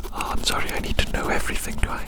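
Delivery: ordinary delivery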